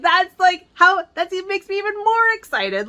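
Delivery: high, loud and gushing; excited gush. Retold or imitated. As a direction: squeal it out like a delighted fan. Retelling own excited reply.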